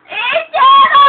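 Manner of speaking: loud, high yell of a name; full-volume high holler; shouted name. Pretend. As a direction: yell to get a rise out of him. Yelling at Ichiro to provoke him.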